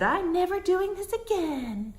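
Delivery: high whiny mock coworker voice; mimicking coworker. Mocking the pregnant coworker's complaint, high whiny voice. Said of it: "And so she said".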